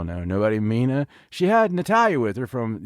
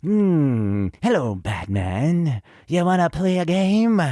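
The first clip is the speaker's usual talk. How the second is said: Joker-like villain voice; 2 semitones higher, slower than usual. Retold or imitated. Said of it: "he's always just like".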